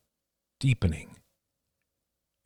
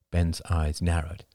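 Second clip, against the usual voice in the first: ordinary delivery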